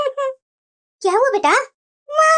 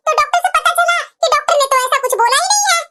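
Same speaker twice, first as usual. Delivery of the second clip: normal talking voice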